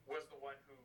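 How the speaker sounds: usual speaking voice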